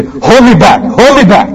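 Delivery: teen pretending to want a fight; hold me back. Teenager in a scuffle, lightly acted bravado. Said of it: "they say".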